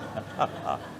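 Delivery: soft airy laugh under the breath; breathy laugh. Real, not acted. Preacher laughing at the ghost story, soft airy laugh. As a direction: let out a quiet breathless laugh.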